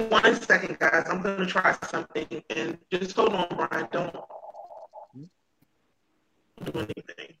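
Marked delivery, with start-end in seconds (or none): 1.1-7.3 faint voice fading out on bad connection; words dropping away on a choppy line; radio breaking up. Real, not acted. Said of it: "am I still coming through choppy".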